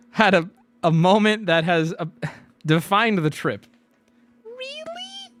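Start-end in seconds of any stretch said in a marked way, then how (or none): 4.4-5.3 very high, breathy squeak of really; high squeaky quoting of Alex; mock falsetto really. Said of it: "That was the quote".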